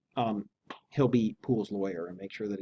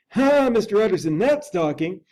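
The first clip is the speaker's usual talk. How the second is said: higher, louder eager cry; relieved servant who finally gets his way; hearty higher exclamation; cried; 7 semitones higher, 6 dB louder than usual. Acted. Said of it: "cried the butler".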